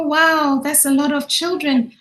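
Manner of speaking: ordinary delivery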